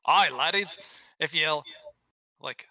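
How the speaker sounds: a bad Gimli-style Scottish accent; Scottish dwarf accent. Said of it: "Can you do a Gimli?".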